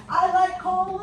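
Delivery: loud high mock cheer; excited body voice. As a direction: shout your delight at the cold. Voicing the body enjoying cold.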